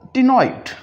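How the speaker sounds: ctenoid said as tinoid, c silent. Shown as a demonstration. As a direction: ctenoid with the c dropped. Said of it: "जिसका ताल्लुक इसी pronunciation से है". (ctenoid में सी खामोश)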